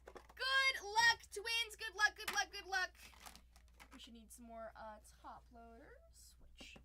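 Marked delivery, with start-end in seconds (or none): 3.9-6.8 whispered aside. Real, not acted. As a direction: whisper it to yourself. Muttering while reaching for supplies.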